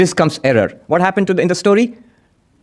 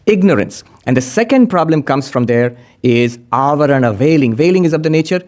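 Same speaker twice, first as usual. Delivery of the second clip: usual speaking voice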